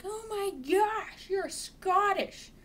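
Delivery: high, loud, drawn-out gushing; mock excited squeal. Acting out meeting a Scottish shopper, mock excited squeal. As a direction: gush it way up high, stretching the words.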